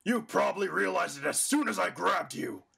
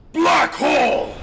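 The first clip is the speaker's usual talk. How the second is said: slow, high, very loud attack call; attack shout; 13 semitones higher, 12 dB louder than usual. Acted. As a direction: roar the move name. Villain calls his attack.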